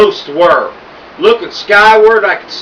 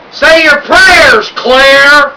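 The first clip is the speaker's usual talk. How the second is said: slow, loud, much higher threat; menacing shout; 6 semitones higher, 6 dB louder, much slower than usual. A narrator's reading. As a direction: drag out the threat at full volume. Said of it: "Duke shouted".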